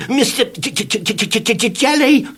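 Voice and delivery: high nervous stammer; nervous man stammers to tramp. Acted. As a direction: stammer his own name. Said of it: "stammered Mister Jelly".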